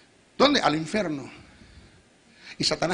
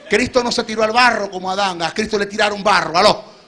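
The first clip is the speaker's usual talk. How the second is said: usual speaking voice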